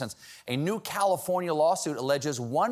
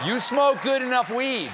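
high, loud scolding; 10 semitones higher, 6 dB louder than usual. Acted. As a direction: yell it at the stoners. Lecturing stoners.

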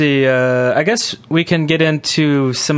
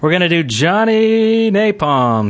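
announcer intro; 4 semitones higher, slower than usual. Acted. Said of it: "a little bit of the old".

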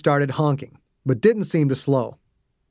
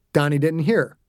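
ordinary delivery, nothing marked

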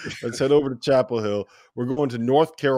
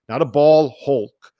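"not a ball hulk" slow, much louder, higher; stress on hulk; 4 semitones higher, 10 dB louder, slower than usual. Acted. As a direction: punch the joke word hulk slowly. Wordplay hawk versus hulk.

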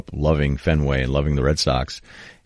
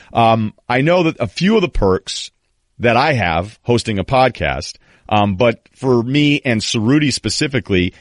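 upbeat podcast sponsor read, higher pitched; 7 semitones higher, 4 dB louder than usual. Real, not acted. Host telling listeners about a sponsor box, lively ad read. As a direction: pitch the product brightly with punched keywords.

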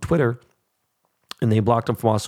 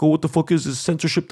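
a higher, sneering whine of a complaining rioter; mocking a whiny rioter; 4 semitones higher, faster than usual. Ranting vlogger mocks Capitol rioters complaining about bans, in a higher whiny voice. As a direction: mimic them whining about censorship.

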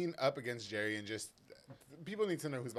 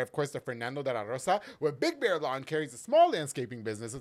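ad read; 6 dB louder than usual. Acted. Sponsor ad read.

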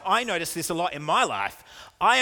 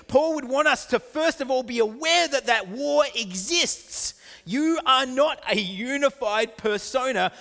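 raised, higher preaching voice; forceful preaching; 7 semitones higher, 5 dB louder than usual. Acted. Preacher warning listeners of a war within, forceful preaching. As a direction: preach it out with emphatic, higher pitch.

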